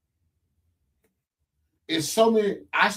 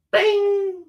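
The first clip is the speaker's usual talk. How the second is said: mock bing sound; 3 dB louder than usual. Light turning on demo.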